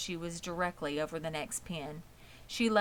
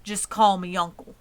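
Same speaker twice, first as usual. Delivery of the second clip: louder, a bluff big pig; big, hearty voice; 9 dB louder than usual. A narrator's reading. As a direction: boom it out heartily. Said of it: "in a big, hearty voice".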